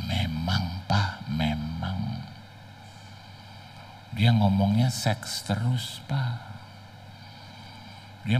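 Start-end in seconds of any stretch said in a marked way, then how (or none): 0.1-2.2 low, drawn-out daughter's confession; soft, slow reply. Retold or imitated. Daughter quietly confessing to her father, slow and low. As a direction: murmur it slowly, low and hushed.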